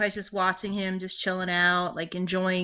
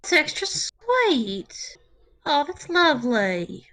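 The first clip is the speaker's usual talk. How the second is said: slow, tearful tenderness, acted; touched; 7 semitones higher, slower than usual. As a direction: draw it out as if moved close to tears. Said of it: "extra touched when he says that".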